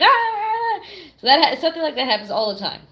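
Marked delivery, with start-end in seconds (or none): 0.0-0.8 high sharp exasperated yelp; mock frustrated shout. Telling her partner, a sudden high mock-frustrated yelp. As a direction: burst out in fake exasperation. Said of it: "Be like".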